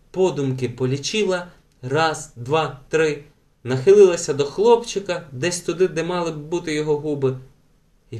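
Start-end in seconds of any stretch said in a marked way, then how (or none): none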